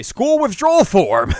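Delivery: dramatic reveal. Retold or imitated.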